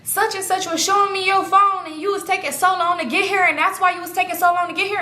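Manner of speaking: high-pitched, insistent telling-off; mock high-pitched nagging; older woman scolding. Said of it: "the older woman, she was just like".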